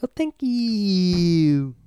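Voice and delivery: high, very slow, drawn-out bad acting; waitress impression. Waitress in bad movie thanking customer, overacted impression. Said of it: "nobody talks like that".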